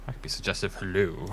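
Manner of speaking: soft, teasing seductive purr; sexy suggestive voice. Pretend. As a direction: play it flirty and sultry, half joking.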